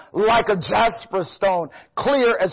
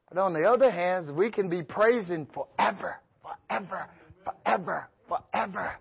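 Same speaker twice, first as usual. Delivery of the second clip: much softer, dreamy forever fading away; hushed praise; 8 dB quieter than usual. Acted. Preacher contrasting the saved praising forever, hushed and fading. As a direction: let it drift off gently, almost to nothing.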